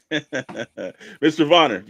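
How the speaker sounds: ordinary delivery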